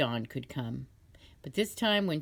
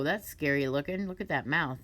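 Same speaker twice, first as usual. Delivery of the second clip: plain reading voice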